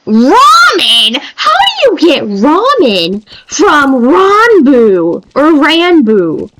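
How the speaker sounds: half-laughing outrage at the name; exasperated disbelief. Retold or imitated. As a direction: daughter protesting dad's mispronunciation. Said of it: "And I'm just like".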